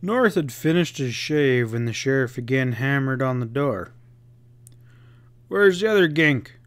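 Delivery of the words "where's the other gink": a pointed, demanding question. A narrator's reading. Sheriff demanding to know where the partner is. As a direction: press him for an answer. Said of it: "Pat demanded".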